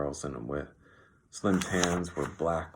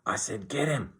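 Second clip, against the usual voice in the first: higher, insistent yell; 7 semitones higher, 4 dB louder than usual. A narrator's reading. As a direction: yell it as an impatient order. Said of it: "George yelled again".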